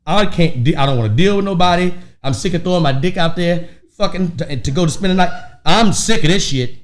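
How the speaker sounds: loud, exasperated venting; fed-up rant. Real, not acted. Comedian ranting to friend about having nowhere to stay, loud. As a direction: vent it, sick of all of it.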